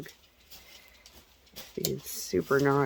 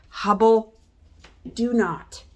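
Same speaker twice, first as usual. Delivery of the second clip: loud, raised command to a dog; sharp warning; 7 semitones higher, 9 dB louder, slower than usual. Real, not acted. Owner stops Hubble before he barks at the kids, sharply. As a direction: call out a firm, high-pitched warning.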